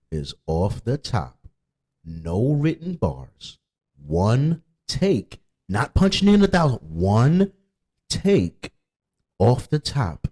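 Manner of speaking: slow, spaced words with one take stressed; emphatic claim. Real, not acted. Rapper insisting to haters his songs are one take, slow and stressed. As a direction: hammer out one take and off the top. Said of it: "emphatic slow claim".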